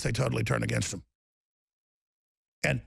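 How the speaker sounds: ordinary delivery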